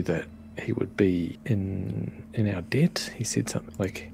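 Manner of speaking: hushed aside to the group; whispering. Acted. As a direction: lean in and whisper it.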